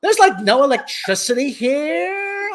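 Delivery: put-on high Valley Girl complaint with an upturn; Valley Girl accent. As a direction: whine it with Valley Girl uptalk. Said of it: "have a Valley Girl accent".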